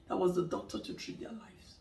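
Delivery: hushed, low whisper; dramatic whispered punchline; whispered reveal. Real, not acted. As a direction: whisper the twist as if a secret. Twist ending of told story.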